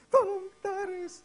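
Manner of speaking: high, slow loud wail; child screaming for help. Retold or imitated. Boy dragged by his father, wailing scream. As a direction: stretch out a screamed plea. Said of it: "dia teriak".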